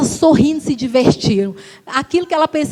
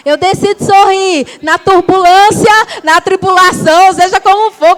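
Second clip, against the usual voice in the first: building louder preaching cadence; 4 semitones higher, 4 dB louder than usual. Real, not acted. Pastor exhorting the church, building to full force.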